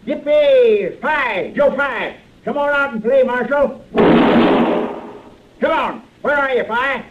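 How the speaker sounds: bait him out of hiding with whoops; taunting call-out. Acted. Cicero calling the fake marshal out of the saloon, high taunting shouts.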